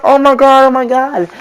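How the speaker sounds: high-pitched mimicked delight; high mock-swooning reaction; imitated delighted squeal. Girl's reaction to surprise proposal.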